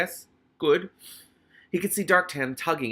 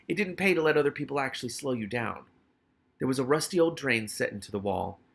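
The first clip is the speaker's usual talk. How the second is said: ordinary delivery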